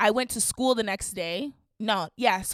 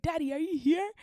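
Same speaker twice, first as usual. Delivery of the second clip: calling out; 7 semitones higher than usual. Retold or imitated. Calling out to stepdad.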